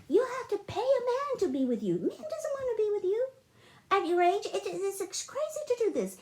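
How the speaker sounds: high-pitched retold rant at mom; high, incredulous telling-off; exasperated scolding. Retold scolding of her mother.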